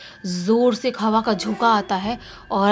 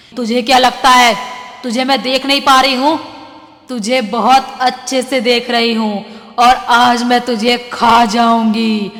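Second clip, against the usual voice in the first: witch yelling; 8 dB louder than usual. Acted. Witch yelling at the hiding boy, loud and menacing. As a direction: bellow it like a cackling witch. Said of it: "ज़ोर से चिल्लाते हुए क्या बोलती है".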